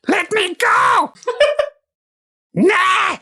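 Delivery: high strangled goblin shriek; screaming little goblin. Acted. Choked goblin begging to be released, screaming. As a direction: screech it like a goblin being choked. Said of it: "hear the little goblin screaming".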